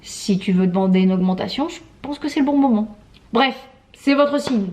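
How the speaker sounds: normal talking voice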